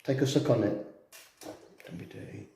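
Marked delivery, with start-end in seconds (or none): 1.9-2.5 hushed, voiceless aside; whispered. Real, not acted. Scolding partner for a cheeky remark, whispered. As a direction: whisper it under your breath. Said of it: "You whispered that".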